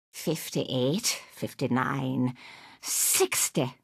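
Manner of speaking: low, soft tallying; counting to herself. Acted.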